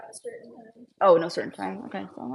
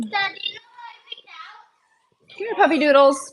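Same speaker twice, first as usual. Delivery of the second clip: much higher, louder call to puppies; high cooing pet voice; 9 semitones higher, 4 dB louder than usual. Real, not acted. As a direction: call them over. Calling puppies.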